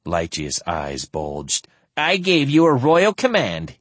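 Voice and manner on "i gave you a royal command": higher, louder indignant shout. Acted. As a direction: shout it like a spoiled prince. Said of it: "he shouted".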